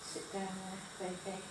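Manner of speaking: very soft, gentle command; hushed to the dog. Real, not acted. Owner soothing her dog to sit, very soft. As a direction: murmur it down to the dog.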